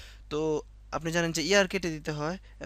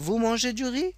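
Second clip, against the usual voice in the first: vous mangez du riz raised and louder, rising on riz; rising question tune; 7 semitones higher, 5 dB louder, much slower than usual. Shown as a demonstration. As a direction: ask it by lifting riz. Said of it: "একটা tone দিতে হবে".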